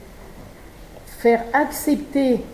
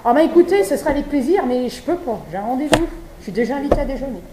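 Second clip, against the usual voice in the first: high, loud, polite refusal from the deputy; deputy declining; 4 semitones higher, 4 dB louder, faster than usual. Acted. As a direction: play the deputy brushing off a vegan lunch, falsely cheerful. (le député refuse l'invitation, voix haute et forte, faussement aimable)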